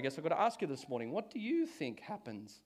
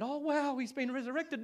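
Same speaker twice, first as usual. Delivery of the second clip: gullible wonder; 8 semitones higher than usual. Retold or imitated. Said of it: "they went".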